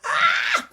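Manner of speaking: very high, loud squeal of delight; short piercing fan scream; excited squeal. Real, not acted. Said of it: "I'm so excited".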